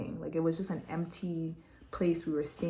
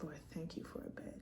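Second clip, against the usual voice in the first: whispered thanks to God; softly whispered prayer; 15 dB quieter than usual. Retold or imitated. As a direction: murmur a grateful prayer. Prayer lying down.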